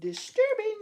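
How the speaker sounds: very high, put-on squeaky voice; high-pitched echo. Streamer comically echoing his own verdict on the picture, high mock voice. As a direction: draw it out in a mock falsetto.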